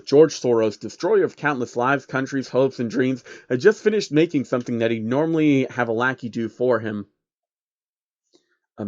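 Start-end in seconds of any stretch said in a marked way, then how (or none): none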